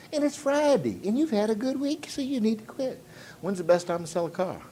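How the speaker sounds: put-on high, soft, coaxing old man voice. Old man giving excuses not to work on rainy Friday, high wheedling voice. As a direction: imitate an old man talking you into quitting. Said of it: "an old man said to me".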